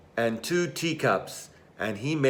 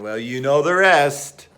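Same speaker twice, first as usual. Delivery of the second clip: ordinary delivery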